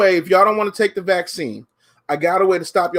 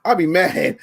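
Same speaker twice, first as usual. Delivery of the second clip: ordinary talk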